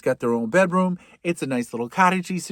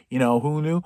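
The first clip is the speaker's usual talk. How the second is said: ordinary talk, nothing marked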